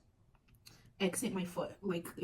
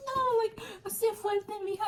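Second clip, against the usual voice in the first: much higher mock-mother plea; mom pleading; 14 semitones higher than usual. Mom begs her daughter to be strong during the probing. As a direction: squeak it like a fretting mom.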